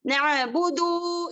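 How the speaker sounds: नाबुदु with the vowel pulled long; wrong lengthening. Shown as a demonstration. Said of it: "इस तरीके से कहेंगे".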